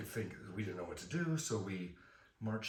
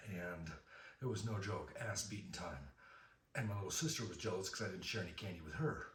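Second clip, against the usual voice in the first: normal talking voice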